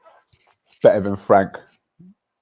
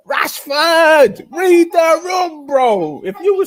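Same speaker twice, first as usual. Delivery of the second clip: loud, high call-out, dragging read the room; shouting at Rashford; 14 semitones higher, 10 dB louder, much slower than usual. Real, not acted. Exasperated fan yelling at Rashford to read the room. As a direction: yell at the player to read the room.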